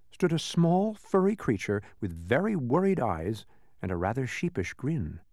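ordinary delivery, nothing marked

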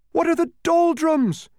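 cried loudly. A narrator's reading. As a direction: call out hard into the empty grey. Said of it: "he cried loudly".

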